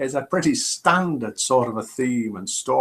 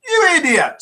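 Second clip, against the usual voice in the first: higher, louder scolding yelp; mock exasperated pharmacist; 6 dB louder than usual. Pharmacist scolding his assistant, hammed-up exasperated yelp. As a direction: scold him like a fool, pitched way up.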